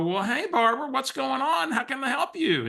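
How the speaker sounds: high, singsong phone politeness; feigned familiarity. Retold or imitated. Replying to a caller he cannot place, high-pitched feigned friendliness. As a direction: play along as if you know the caller.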